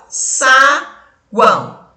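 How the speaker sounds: "saguão" said slowly in two parts, sa... guão; split into syllables. Shown as a demonstration. Teacher separating the syllables of "saguão" for pupils, slow and split. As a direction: separate sa from guão with a long pause. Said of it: "Separei a sílaba".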